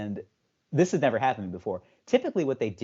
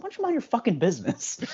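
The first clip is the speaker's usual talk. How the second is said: mock indignation; 13 semitones higher than usual. Mock indignant comeback to doctor.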